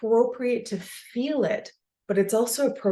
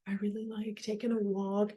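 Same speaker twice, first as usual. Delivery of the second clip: much softer, offhand mock-bland; bland mumble; 9 dB quieter than usual. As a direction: throw it away softly. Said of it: "or like".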